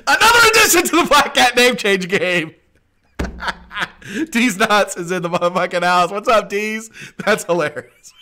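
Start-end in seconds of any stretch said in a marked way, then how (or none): 0.1-6.9 high, loud announcer call broken by giggles; laughing hype. Real, not acted. Host announcing a joke name while cracking up, laughing.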